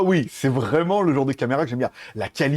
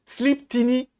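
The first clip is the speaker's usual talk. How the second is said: ordinary delivery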